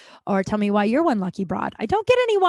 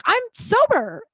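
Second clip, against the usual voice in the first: mock proud announcement; 12 semitones higher, 3 dB louder, slower than usual. Announcing sobriety to bartender.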